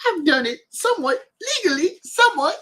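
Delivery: squeaky high comic impression; Cat Williams. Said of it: "[name] as, like, Cat Williams".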